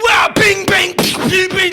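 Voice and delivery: loud high shouted bing bang; yelled cartoon impact noises; crash noises. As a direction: smash sound effects at full voice. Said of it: "And he's like".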